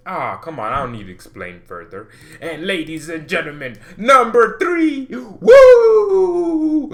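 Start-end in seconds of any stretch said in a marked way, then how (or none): 2.4-6.9 loud, high announcer hype. Pretend. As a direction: hype up the reveal like a host. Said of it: "announcing the countdown".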